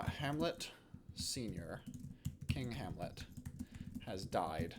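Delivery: slow, halting dictation with long pauses; composing aloud. Real, not acted. Teacher drafting a play summary for students, slow dictation. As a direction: dictate softly to yourself, phrase by phrase.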